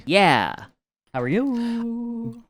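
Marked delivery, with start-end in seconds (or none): 1.1-2.4 high, very slow sing-out of each word; exaggerated drawn-out greeting. Real, not acted. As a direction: stretch the vowels way out, pitched high. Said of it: "why you said it like that".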